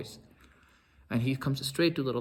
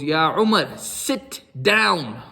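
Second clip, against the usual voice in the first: much louder, stern, final order; stern command; 9 dB louder, much slower than usual. Retold or imitated. Abu Bakr silencing the yelling Umar, stern loud command. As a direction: command him to sit and brook no refusal. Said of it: "so stern with Umar".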